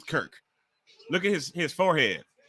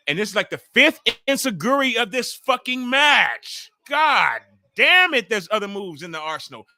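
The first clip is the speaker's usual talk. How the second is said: much louder, rising to a higher, slowed exasperated shout; angry rant; 6 semitones higher, 6 dB louder than usual. Real, not acted. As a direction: blow up at the screen. Fed up with fifth enziguri.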